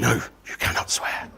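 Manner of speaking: hushed, strict refusal; stern whisper. Retold or imitated. Organiser quietly forbids him to swear, stern whisper. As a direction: whisper it firmly.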